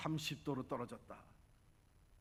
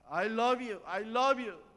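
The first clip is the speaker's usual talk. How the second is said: loud, high shout of love; child's shout; 10 semitones higher, 14 dB louder, much slower than usual. Retold or imitated. Girl shouting love from the hilltop, loud and high. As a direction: shout it across the valley. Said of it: "아이가 소리 지릅니다".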